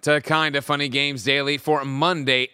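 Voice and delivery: loud, high announcer welcome; big host welcome, pitched high and loud; hyped show intro. Acted. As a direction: kick off the show like a hype host.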